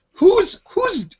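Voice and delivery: high, baffled demand; incredulous. Real, not acted. Annoyed customer asking the joking clerk his motives, incredulous and high. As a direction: demand answers in disbelief, pitched well up.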